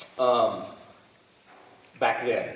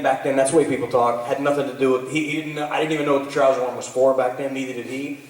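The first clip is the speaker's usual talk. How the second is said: ordinary talk, nothing marked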